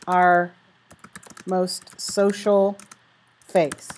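reading a model sentence aloud, very slowly; slow, deliberate reading. Shown as a demonstration. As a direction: lay each phrase down with pauses. Reads her model conclusion slowly.

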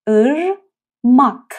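ارماک stretched very slowly, each syllable apart; slow syllable demo. Shown as a demonstration. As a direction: drag out ارماک slowly for learners. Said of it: "از طریق بینی تلفظ بشه". (ارماک آهسته و کشیده)

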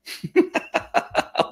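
high, voiceless chuckle; laugh. Real, not acted. Reading a congratulations note on blood pressure, high chuckle. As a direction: laugh in surprise at good news.

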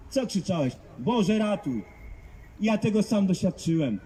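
usual speaking voice

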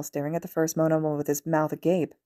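ordinary delivery, nothing marked

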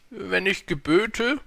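high, slow, stately king; dozierender König. A narrator's reading. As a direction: pronounce it like a self-important ruler. King explains his authority. (hoheitsvoll gedehnte Königsstimme)

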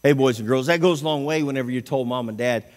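ordinary talk, nothing marked

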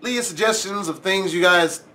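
low, steady sign-off read; outro plug. Acted. YouTuber tells viewers to like and subscribe, calm outro read. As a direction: wrap up the video for subscribers.

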